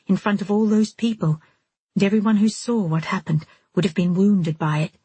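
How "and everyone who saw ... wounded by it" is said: usual speaking voice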